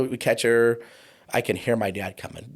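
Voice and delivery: ordinary talk, nothing marked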